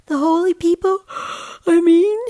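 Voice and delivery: put-on anxious kid pressing; young boy voice. A narrator's reading. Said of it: "a jaw-cracking yawn".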